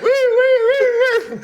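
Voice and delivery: loud, very high wailing whoops; imitated police siren. Cop coming round the corner, imitated siren. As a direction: wail like a squad car siren.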